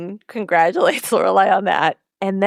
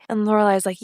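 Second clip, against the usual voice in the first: usual speaking voice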